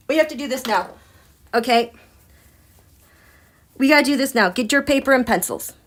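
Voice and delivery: ordinary talk, nothing marked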